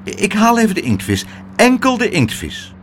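high-pitched, hurried reassurance; flustered young voice, mildly rushed; hasty backtrack. A narrator's reading. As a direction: placate quickly, stressing "enkel". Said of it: "zei Pluk haastig".

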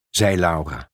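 usual speaking voice

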